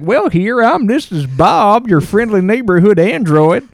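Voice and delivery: a hint of a folksy cowboy drawl; lightly voiced Southern cowboy robot; Slim Pickens voice. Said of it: "his voice talked a little bit like this".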